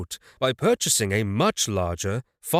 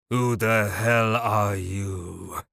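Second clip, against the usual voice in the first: slow, held-back fury; angry and holding it in; restrained anger; 3 semitones lower, much slower than usual. A narrator's reading. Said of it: "barely restraining his anger".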